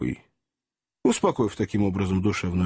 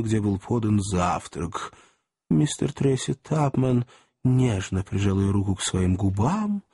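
ordinary delivery, nothing marked

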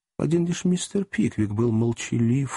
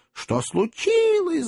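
high, slow shriek of alarm; shrieking ladies; slower than usual. Acted. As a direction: squeal it out in a fright. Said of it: "завизжали леди".

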